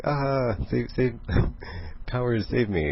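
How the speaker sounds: high, playful plea for rescue; hammed-up cry for help; mock panic. Eva in a time bubble begging her powers, mock panic.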